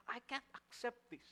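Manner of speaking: high, strained voice of stubborn Jonah; Jonah refusing. Acted. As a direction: push back like a sulking prophet. Jonah voiced resisting God's mercy.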